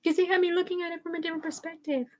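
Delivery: soft, high-pitched imitation; imitating a woman. Quoting the sweet one who cries with Tiffany, imitated in a soft high voice. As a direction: put on a small, high voice for her. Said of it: "She's like".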